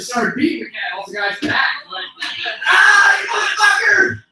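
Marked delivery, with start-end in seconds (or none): 2.7-4.2 high, loud battle cry; attacking yell. Acted. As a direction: yell it while beating off an attacking cat. Beating cat off man's back.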